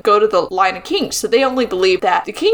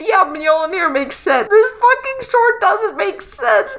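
slow, high exasperated complaint; pained, drawn-out grievance; exasperated whine; 8 semitones higher, slower than usual. Real, not acted. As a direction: drag out the frustration. Exasperated rant continues.

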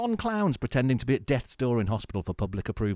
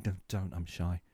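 shy murmur; 5 semitones lower, 7 dB quieter than usual. Pretend. Extrovert vs introvert act.

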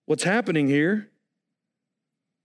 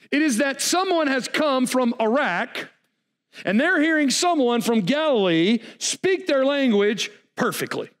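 fiery preaching; 7 semitones higher than usual. Acted. Preaching at full force.

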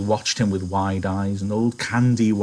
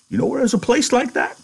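put-on much higher, quavering old man; old Candy; 14 semitones higher, faster than usual. Candy asking George about the farm, old and hopeful.

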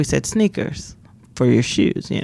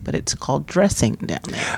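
normal talking voice

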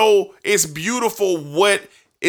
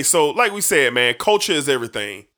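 ordinary delivery

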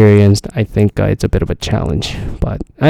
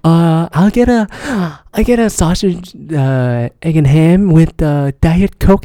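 mocking customer; 7 semitones higher, slower than usual. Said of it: "what the fuck do they get".

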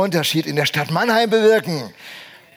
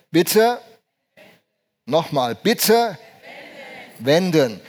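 slow, evenly spaced robot announcer; navi voice; slower than usual. Retold or imitated. Spaced-out machine prompt with long pauses. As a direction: pastor to congregation, imitating a car navi's announcement. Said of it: "was sagt das Navi dann zu uns?".

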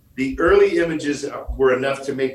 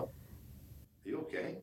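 whispered, much softer, voice gone; 24 dB quieter, faster than usual. Retold or imitated. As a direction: breathe the line with no voice. Quoting a cliché movie line.

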